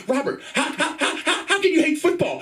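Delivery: very high, sputtering mom voice; hammed-up high-pitched dismay; aghast mother. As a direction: stammer in comic disbelief as a horrified mother. Mother aghast at son hating football.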